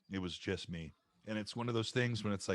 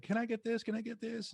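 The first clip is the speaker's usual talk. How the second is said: put-on high, pleading kid's voice, much higher; begging kid; 13 semitones higher than usual. Boy begging his mom for the pay-per-view, high and pleading. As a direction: whine it like an eager kid begging.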